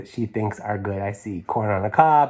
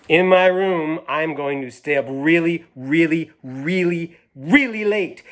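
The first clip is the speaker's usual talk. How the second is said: building excited read-aloud; reading to children; 3 semitones higher than usual. A narrator's reading. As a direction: climb higher on each really. Reads girl's plans.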